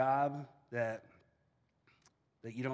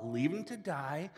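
normal talking voice

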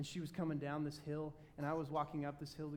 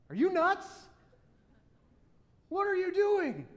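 high, loud disbelief; imitating wife's shocked outburst; incredulous wife; 13 dB louder than usual. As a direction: shout it like she thinks you've lost it. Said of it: "Becky would have looked at me like".

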